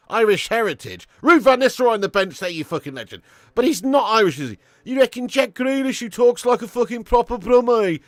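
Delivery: loud, high, insistent rant; heated argument. Real, not acted. Streamer arguing with a viewer over Irish lineage, heated and insistent. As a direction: drive each point home loudly.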